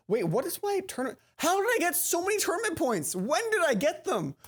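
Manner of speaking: can't believe the score; baffled surprise. Real, not acted. Baffled by tournament points.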